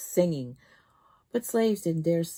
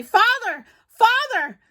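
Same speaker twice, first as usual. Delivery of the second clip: put-on children calling out; 10 dB louder, slower than usual. A narrator's reading. Children calling from slave cart.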